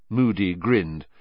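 plain reading voice

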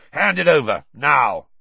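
loud firm command; order. A narrator's reading. Moody ordering Filch to hand over the egg, loud. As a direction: bark the order.

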